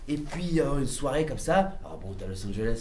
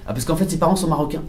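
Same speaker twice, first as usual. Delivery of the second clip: ordinary talk, nothing marked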